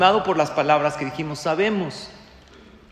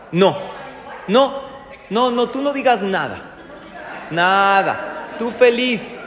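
loud, higher, fast no no no; shushing the wife; 4 semitones higher, 4 dB louder than usual. Retold or imitated. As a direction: cut her off, half joking. Husband silencing wife in car.

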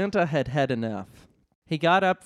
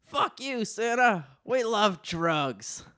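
high, squeaky elf jeer; elf yelling; 7 semitones higher, slower than usual. Acted. Elf Henry jeering at Santa, high squeaky yell. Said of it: "An elf named Henry yelled out".

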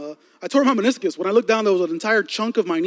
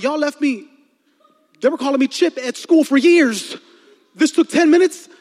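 excited outburst, pitched high; 7 semitones higher, 6 dB louder than usual. Retold or imitated. Speaker recalls reacting to a new tooth, excited. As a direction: retell it with indignant excitement, louder by the end. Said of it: "as excited as I was".

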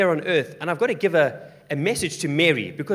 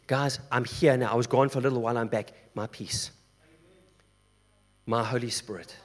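reassuring Jesus; 5 semitones lower than usual. Acted.

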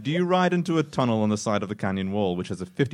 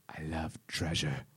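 low, slow, hushed confession; whispered; 4 semitones lower, 9 dB quieter, slower than usual. Acted. Narrator leaning close to the rider's ear, hushed. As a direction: murmur it softly and slowly. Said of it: "lean in close and whisper".

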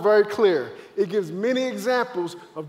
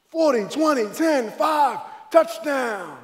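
higher, punchy countdown to the end zone; play-by-play call; 4 semitones higher, slower than usual. Acted. Runner counting yard lines down the field, excited call. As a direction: call your own run like an announcer. Mimes calling his touchdown run.

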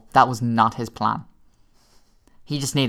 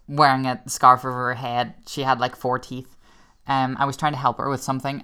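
usual speaking voice

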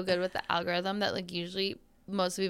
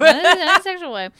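loud, high, laughing protest; laughing disclaimer; 9 semitones higher, 14 dB louder than usual. Real, not acted. Host clarifying an awkward remark for listeners, laughing loudly. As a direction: blurt the clarification through real laughter.

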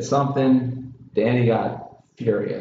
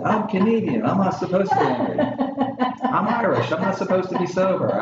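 pitched-up, hammed-up indignation; mock angry rant; 8 semitones higher than usual. Host mocking the producer's angry nationality excuse. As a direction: imitate the producer's outburst, then twist it. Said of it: "in the heat of battle".

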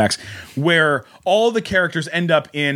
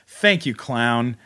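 slow earnest thanks; 3 semitones lower, much slower than usual. Retold or imitated. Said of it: "And she says".

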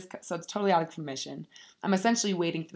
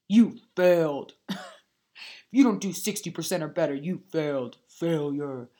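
mock stern teacher; 2 semitones lower, 4 dB louder than usual. Mocking teacher grading her.

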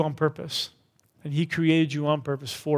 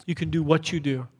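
normal talking voice

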